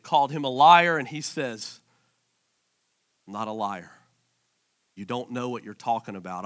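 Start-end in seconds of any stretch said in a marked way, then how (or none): 3.3-6.4 low, soft, measured reply; quiet denial. Acted. Jesus answering the Pharisees' accusation, quietly firm. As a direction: answer the accusation softly, voice dropped.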